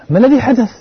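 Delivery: sudden high, loud alarmed question. Retold or imitated. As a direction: blurt it out in shock, high and loud. Daughter comes home crying. (سؤال مفزوع عالٍ ومفاجئ)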